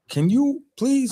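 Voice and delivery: mock plea. Pleading as co-host reacts.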